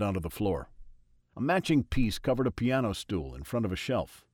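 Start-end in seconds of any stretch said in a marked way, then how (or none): none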